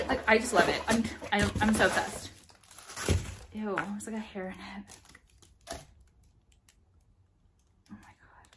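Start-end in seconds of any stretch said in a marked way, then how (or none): 3.5-8.5 hushed whisper with a touch of disgust. Real, not acted. Vlogger finding a hair in the package, whispering. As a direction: drop to a whisper over the hair in the box.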